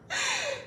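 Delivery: a short high scornful laugh. Real, not acted. Big sister laughing off the cheater's claims, scornful laugh. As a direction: laugh him off in disbelief.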